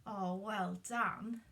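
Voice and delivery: plain reading voice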